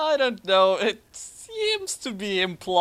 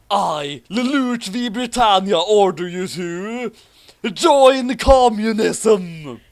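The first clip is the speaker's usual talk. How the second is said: louder and higher, very slow commanding proclamation; grand villain decree; 6 semitones higher, 8 dB louder, slower than usual. Acted. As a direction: declare it like an evil emperor, dragging it out.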